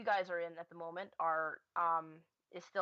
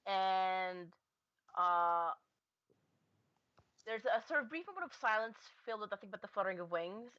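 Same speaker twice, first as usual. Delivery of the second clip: ordinary talk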